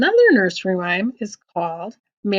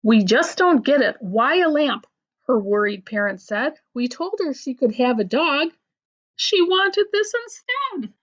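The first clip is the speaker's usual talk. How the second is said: lively storybook reading with voiced bits; picture-book read-aloud; reading to children; 3 semitones higher than usual. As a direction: read the silly poem to a child. Reads a funny rhyme book aloud.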